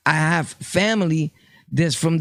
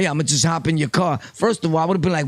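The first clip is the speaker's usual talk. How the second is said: ordinary talk, nothing marked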